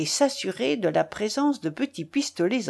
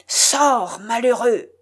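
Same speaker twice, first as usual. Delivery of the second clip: higher, louder outraged order; crying out; 4 semitones higher, 5 dB louder, slower than usual. A narrator's reading. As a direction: banish him with a raised voice. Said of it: "s'écria l'évêque". (ordre crié avec indignation)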